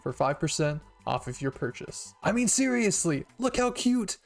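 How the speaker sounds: high, loud, bubbly sponsor pitch; loud, high promo voice for a cute product; upbeat ad read. Acted. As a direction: sell it like an excited influencer.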